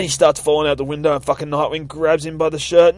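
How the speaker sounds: usual speaking voice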